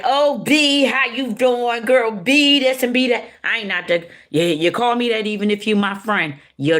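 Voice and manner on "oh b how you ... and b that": loud, high-pitched mock girl talk; girls' gushy greeting. Mimicking how girls greet each other, gushy greeting. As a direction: imitate girls hollering at a friend. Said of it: "how girls be like".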